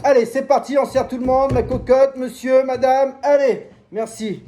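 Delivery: breathless end of a loud sales pitch; winded, pushing out the last words; out of breath. Real, not acted. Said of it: "pff, je suis essoufflé".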